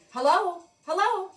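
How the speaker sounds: loud high urgent hellos; calling hello. Acted. Firemen answering the silent call, loud and high. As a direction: call into the phone, puzzled and urgent. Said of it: "they said".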